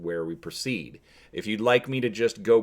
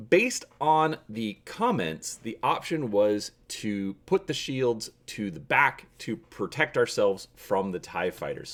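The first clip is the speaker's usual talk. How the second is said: ordinary delivery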